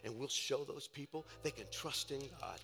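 low, soft preacher's voice with long pauses; hushed testimony. Real, not acted. Preacher recounting the risen oil to the church, hushed. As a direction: drop right down and let each phrase sit.

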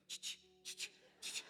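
imitated clicking. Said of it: "she was just like".

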